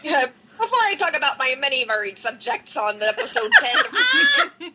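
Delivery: mock Minnesota accent through laughter; Midwestern accent. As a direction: read it like an exaggerated Minnesotan. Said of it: "read that with the accent".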